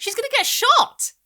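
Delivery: put-on high, loud whiny little girl; insist, pleading to be believed; insistent child. A narrator's reading. Girl insisting to mum, high and whiny.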